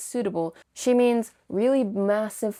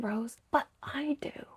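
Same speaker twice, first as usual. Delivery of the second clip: hushed, high, slow confession; soft private admission; whispered aside; 8 semitones higher, 5 dB quieter than usual. Retold or imitated. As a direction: breathe it out like a secret. Din's pained inner thought.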